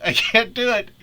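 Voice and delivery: high, loud laughing give-up. Real, not acted. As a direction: crack up mid-sentence. Cracking up at the idea.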